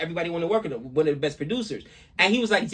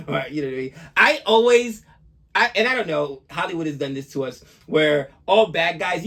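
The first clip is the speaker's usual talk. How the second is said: usual speaking voice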